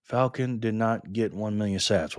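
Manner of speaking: ordinary talk, nothing marked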